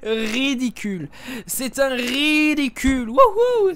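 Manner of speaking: mocking. Mocking the opponent.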